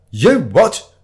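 loud, high, affronted bellow; roar. Acted. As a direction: roar it at him. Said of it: "roared the red-faced man".

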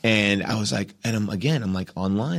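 usual speaking voice

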